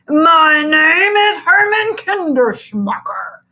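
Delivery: growling. A narrator's reading. A menacing new teacher introducing himself, slow and loud. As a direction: announce yourself slowly like a threat. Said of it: "growled the man".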